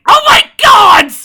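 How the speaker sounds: very high, very loud shriek; shocked scream. Real, not acted. Player shrieking at the dice roll, shocked scream.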